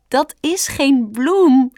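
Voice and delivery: high, loud, drawn-out amusement; Stampertje giggling. A narrator's reading. Rabbit amused at the fawn's mistake, giggling. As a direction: correct your friend slowly, highly amused. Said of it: "giechelde Stampertje".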